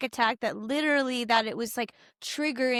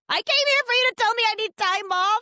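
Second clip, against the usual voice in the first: higher, louder, exasperated disbelief; incredulous outrage; 10 semitones higher, 4 dB louder than usual. Retold or imitated. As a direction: lash out at the doctor in disbelief. Outraged at doctor's advice.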